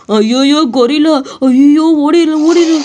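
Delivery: spot the gorilla and scream to flee; terrified shout to run; panicked yell. Acted. Thief sees a gorilla.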